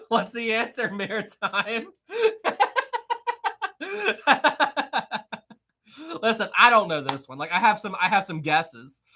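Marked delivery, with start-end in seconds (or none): 2.1-5.4 real high-pitched laugh bursting out; high burst of laughter. Real, not acted. Streamer laughs at chat stalling Maritime, high laugh.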